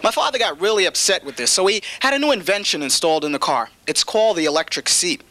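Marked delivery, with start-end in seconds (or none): none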